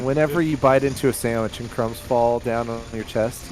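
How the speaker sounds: ordinary talk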